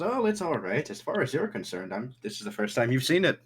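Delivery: high, swooping, forgiving host; Thatch. Acted. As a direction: brush it off in a lifted character voice. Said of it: "Thatch goes".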